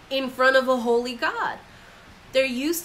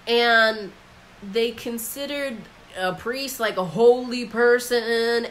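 ordinary talk

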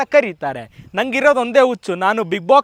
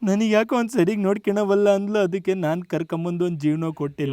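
subdued moan sinking lower; mournful confession; 5 semitones lower, 4 dB quieter than usual. Acted. Husband saying he gave her a life, mournful. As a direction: let it sink into slow self-pity. Sad husband tells marriage story.

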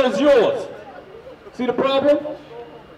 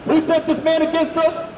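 pitched up, calling out the question to the reader; raised preaching call; 5 semitones higher than usual. Real, not acted. Preacher calls question to scripture reader, raised voice. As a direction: fire the question at him like a street preacher.

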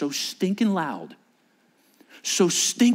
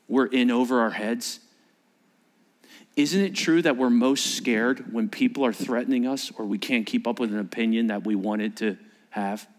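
ordinary delivery

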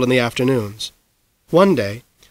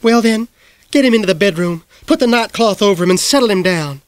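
raised, exasperated order; Miss Blueglass voice; 7 semitones higher than usual. A narrator's reading. Miss Blueglass ordering her sister, exasperated. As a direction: tell her off firmly.

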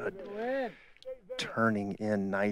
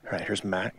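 ordinary talk, nothing marked

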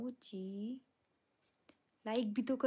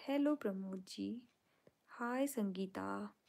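ordinary delivery

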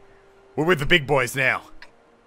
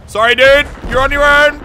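loud mock apology; 10 semitones higher, 6 dB louder than usual. Real, not acted. Abandoning teammate while fleeing.

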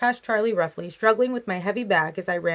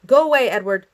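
raised, louder narrated yell; 3 semitones higher, 7 dB louder than usual. A narrator's reading. Girl sending Edward away at the door, yelling. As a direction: yell it out, short of full volume. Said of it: "I yelled at him".

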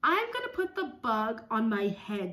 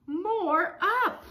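put-on child's plea; 9 semitones higher, 7 dB louder than usual. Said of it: "She says".

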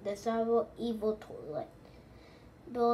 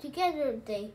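ordinary delivery

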